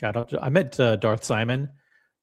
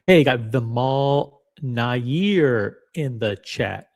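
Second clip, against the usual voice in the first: normal talking voice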